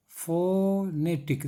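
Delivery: Phonetic very slow, FOOO-NE-TIC; syllable breakdown. Shown as a demonstration. Teacher counting puffs of air in phonetic, slow syllable-by-syllable delivery. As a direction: break phonetic into three beats, long first O. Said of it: "तीन हवा का झोंका".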